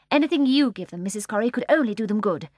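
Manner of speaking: polite and prim; surprisingly courteous nanny; courteous Mary Poppins. A narrator's reading. Mary Poppins politely to the old lady, courteous. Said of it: "with most surprising courtesy".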